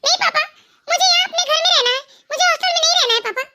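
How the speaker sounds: put-on high child's voice, pleading; a small girl begging not to be sent away; begging little girl. Little girl begs her father not to send her to hostel.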